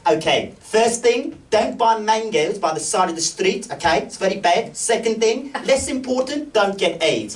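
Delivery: put-on high, slow, motherly voice of an older South African lady; Doris the landlady. Comedian imitating his host Doris giving advice, high and slow. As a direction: lay down the rules kindly like a hostess. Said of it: "She goes".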